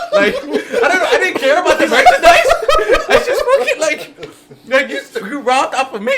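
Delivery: high, loud, laughing through the words. Real, not acted. Telling friends how he chased a thief, laughing hard. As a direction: crack up while telling it.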